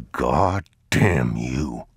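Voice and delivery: low, slow, grim father's curse; a weary father's slow curse. Acted.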